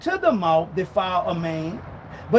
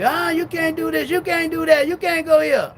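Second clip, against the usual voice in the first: higher mock scolding; high mock preacher lecture; 5 semitones higher than usual. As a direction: nag in a high put-on voice. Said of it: "Man gonna say".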